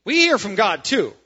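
loud, halting protest to the leader; mock objection. Imagined church member stopping Peter mid-decision, mock objection. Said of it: "No record of anybody going".

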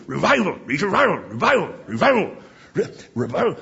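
"read your Bible" blurred until it becomes "revival"; imitating Doctor Ebling. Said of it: "it would sound like revival".

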